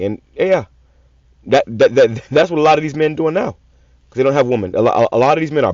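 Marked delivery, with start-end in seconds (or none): none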